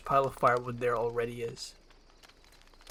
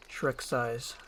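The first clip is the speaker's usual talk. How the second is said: usual speaking voice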